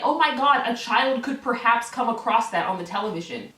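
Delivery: mock horror. Mocking worries about R-rated movies, mock horror. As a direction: imitate an appalled parent, overdone. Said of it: "Do you feel that way about movies".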